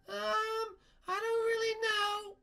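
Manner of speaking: very high singsong falsetto; Princess Peach. Pretend. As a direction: squeak an unsure answer. Peach answers.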